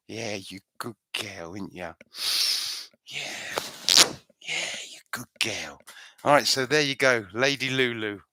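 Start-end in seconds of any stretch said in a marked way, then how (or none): 0.1-5.8 hushed, low doting murmur fading into whisper. Shown as a demonstration. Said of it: "and I whisper in her ear".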